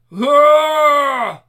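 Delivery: loud, high rage roar; roaring battle yell. Acted. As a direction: let out a furious roar.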